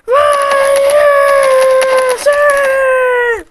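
very high, loud charging yells; high, loud toy soldiers charging; battle cry. Pretend. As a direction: cheer the troops forward.

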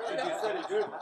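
hammed-up Scottish accent with laughter; mock Scottish accent. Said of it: "How about Scottish?".

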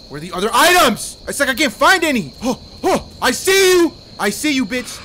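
loud, high, agitated yelling; frantic shouting. Real, not acted. Player hunting items as Chica appears, frantic. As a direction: rant urgently at the game.

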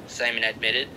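ordinary delivery

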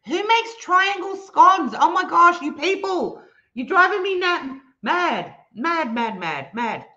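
high, loud outraged rant fading; mock exasperation. Real, not acted. Ranting at chat about triangle scones, mock outrage fading out. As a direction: rant as if driven mad.

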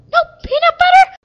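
loud high outraged whine. Pretend. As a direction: protest in disbelief. Diva outraged.

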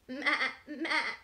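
loud laughing goat bleat. Acted. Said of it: "said the goat".

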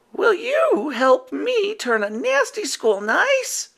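put-on rally the kids; high hopeful werewolf; Howler asking. A narrator's reading.

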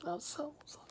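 breaking down in broken whispers; real crying. Real, not acted. Apologising to girlfriend on camera, real whispered crying. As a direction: sob it out, barely voiced. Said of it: "I can't cry no more".

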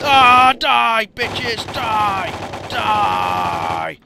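loud, drawn-out war cry; shouted attack cry; battle yell. Real, not acted. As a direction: roar it while firing at enemies. Firing wildly at enemies.

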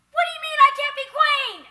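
loud high outburst, shocked and indignant; outraged shout. Acted. Told she can't be queen, yelling back at him in outrage. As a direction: explode in disbelief.

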